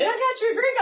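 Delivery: high, laughing imitation of the guy; mock young guy. Retelling the bar guy offering her a drink, mocking. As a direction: squeak it out mocking him. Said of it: "He's like".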